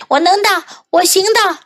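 high-pitched boast; boasting gingerbread man. A narrator's reading. Gingerbread man boasts to the pig, high-pitched. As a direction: insist in a high voice.

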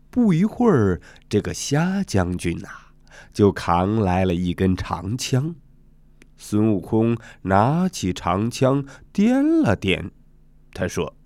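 plain reading voice

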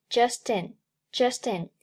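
"just in" joined, t carried into "in"; linked pronunciation. Shown as a demonstration. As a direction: link "just in" as one word. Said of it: "Just和in连读".

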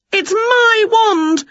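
high, slow, drawn-out shout. A narrator's reading. Witch diving for her wand, high slow shout. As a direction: stress on my. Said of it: "shouted Winnie".

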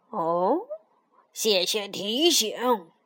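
low, slow dim turtle voice; drawn-out turtle thanks; snapping turtle. A narrator's reading.

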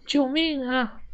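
high, thin-volume cry for rescue; straining cry for help. A narrator's reading. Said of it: "我扯着嗓子喊道". (扯着嗓子喊救命)

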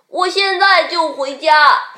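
high, loud cub reply; little bear. A narrator's reading. Little bear tells mother it is coming home, calling eagerly. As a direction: answer mother's call eagerly. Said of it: "小熊说".

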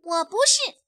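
put-on high, loud little-kid denial; defiant denial. A narrator's reading. Little bunny pointing at the bully, denying high and loud. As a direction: shoot it straight back. Little rabbit answers back.

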